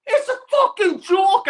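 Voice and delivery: high, slow exasperated outburst; disgusted rant at the team. Real, not acted. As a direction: throw the words up in disbelief. Ranting about the club.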